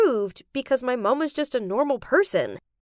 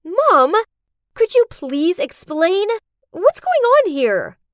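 loud, higher demanding yell; screaming at mom; 7 semitones higher, 7 dB louder than usual. Acted. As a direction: shout at her for answers. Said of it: "I screamed".

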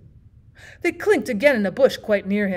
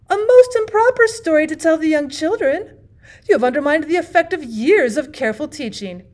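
much higher and louder, scandalised reproach; indignant aunt; 6 semitones higher, 6 dB louder than usual. Acted. The aunt rebukes the bachelor for his improper story, indignant. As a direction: scold like a prim aunt offended.

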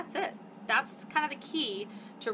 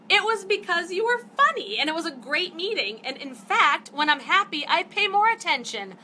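real indignation, higher and louder; fired up; 7 semitones higher, 7 dB louder than usual. Real, not acted. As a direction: rant with rising heat, defending yourself. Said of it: "I'm getting all fired up".

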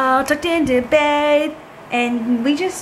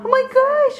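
surprised squeal; 12 semitones higher than usual. Real, not acted. Surprise at dog.

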